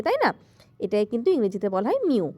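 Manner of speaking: ordinary delivery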